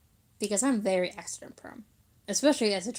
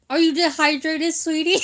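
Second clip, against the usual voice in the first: louder, higher mock-concerned nana; hammed-up nana concern, pitched up; imitated nana; 6 semitones higher, 6 dB louder than usual. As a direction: play a fussing grandmother asking after a child. Acts out nana's worried question.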